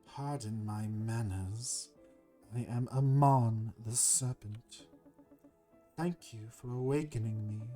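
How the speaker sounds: slow, grand serpent voice; courteous serpent. Acted. Serpent thanks the hero who woke him, slow and stately. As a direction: draw it out slowly, with formal courtesy.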